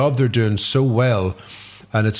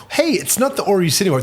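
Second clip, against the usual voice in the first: mock cheerful official; 8 semitones higher, faster than usual. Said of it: "It's like".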